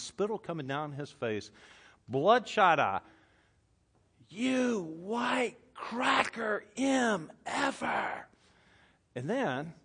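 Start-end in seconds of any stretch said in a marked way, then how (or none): 4.3-8.3 high stretched mock scream; screaming man. Pastor imitating a man screaming at him, high and stretched. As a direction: ham up a deranged man's yell. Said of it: "he screams at the top of his lungs".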